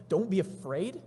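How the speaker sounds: rising, questioning disbelief; acted astonished repeat, lifting at the end; incredulous echo. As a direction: throw the words back as an absurd question. Onlooker throws the words back incredulously.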